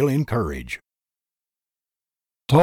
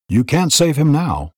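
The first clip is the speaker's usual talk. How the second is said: loud and higher, cutting; strident; 6 semitones higher, 4 dB louder than usual. A narrator's reading. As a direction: cut her off stridently. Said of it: "replied Tull stridently".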